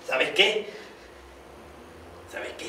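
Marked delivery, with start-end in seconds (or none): 2.3-2.7 almost whispered, much softer; secretive whispered aside; hushed. Acted. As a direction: drop to a confiding hush. Repeats the phrase, second time hushed.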